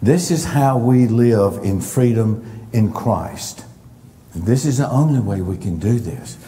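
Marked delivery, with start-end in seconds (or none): none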